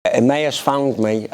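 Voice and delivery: ordinary delivery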